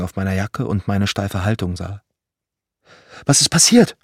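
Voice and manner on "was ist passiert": much higher and louder, frightened mother's question; mother startled at the state of her son; shrill, alarmed. Acted. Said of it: "Ihre Stimme schrill".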